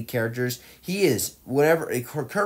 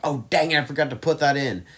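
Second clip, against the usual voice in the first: raised, louder self-scolding; mock annoyance; 5 semitones higher, 4 dB louder than usual. Imagining himself noticing a missed scene, mildly annoyed. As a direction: act out mild anger at your own slip. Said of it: "I'm gonna be angry".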